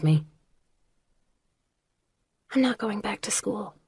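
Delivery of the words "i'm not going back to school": announcing quietly. A narrator's reading. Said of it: "she announced".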